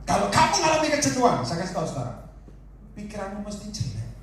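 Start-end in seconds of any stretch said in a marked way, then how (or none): 0.1-1.4 fast, high and loud; preaching burst. Acted. Preacher calling out to the congregation, fast and loud. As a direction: launch the point with energy.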